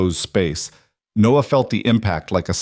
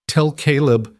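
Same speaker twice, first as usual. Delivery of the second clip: gasped; 6 semitones higher than usual. A narrator's reading. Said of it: "he gasped".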